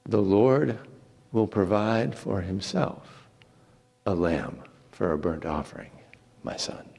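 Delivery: a father gently hiding his grief; low, fading old man's reply; old Abraham. Acted. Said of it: "And Abraham said".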